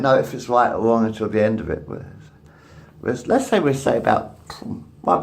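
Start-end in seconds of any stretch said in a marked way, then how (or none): none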